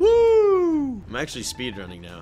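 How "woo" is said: very high, loud whoop; triumphant whoop; cheer. Real, not acted. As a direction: celebrate a big jump. Cheering in-game success.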